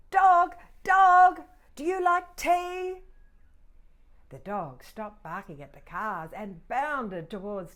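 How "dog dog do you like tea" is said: loud high call to a dog. Acted. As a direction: shout it down the road to the dog. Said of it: "She called out to the dog".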